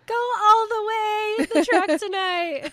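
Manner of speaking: high, very loud cheering yell; gleeful full-volume goading; playful egging-on shout. Pretend. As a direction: holler it like an overexcited fan. Shouts encouragement to the character.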